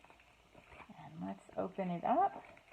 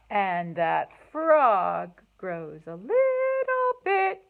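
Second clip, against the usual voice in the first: lively, singsong storybook reading, louder on refrains; reading to children; 2 semitones higher, 6 dB louder than usual. A narrator's reading. Teacher reading a cumulative picture book to kids, lively. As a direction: read it aloud with bounce for little ones.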